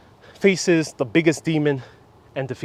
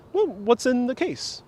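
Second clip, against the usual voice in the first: acted question; 8 semitones higher, 4 dB quieter than usual. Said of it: "And he asks the monkey".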